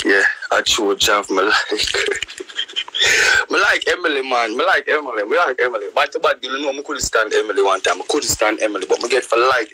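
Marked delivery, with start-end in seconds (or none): 1.8-3.4 whispered laugh. Real, not acted.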